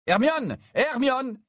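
high, urgent calls of a name; calling out. Acted. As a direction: call across a crowded party. Harry calls out to Hermione.

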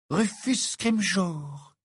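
high, very slow dreamy question; dreamy girl voice. A narrator's reading. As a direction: drift over the name.

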